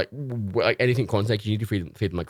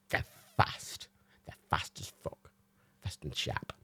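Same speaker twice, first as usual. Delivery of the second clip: whispered and slower; hushed emphasis; 5 semitones lower, 11 dB quieter, slower than usual. Real, not acted. Warning that snakes are fast, whispered. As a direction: drop to a whisper for effect.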